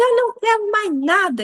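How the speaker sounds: high-pitched little-girl defiance, imitated; defiant toddler. Mom imitating her daughter refusing the diaper, defiant toddler. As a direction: mimic a small child refusing everything. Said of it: "ela falou comigo assim".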